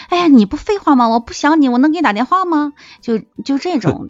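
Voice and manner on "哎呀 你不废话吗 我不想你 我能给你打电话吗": higher, louder mock-exasperated comeback; teasing retort. Friend bickering back on the phone, mock-exasperated. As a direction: bicker back playfully, pitched up and loud.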